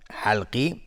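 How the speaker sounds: ordinary talk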